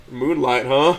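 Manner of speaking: raising a quizzical eyebrow at the name; Moonlight, huh said quizzically. A narrator's reading. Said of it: "I quizzically look at her".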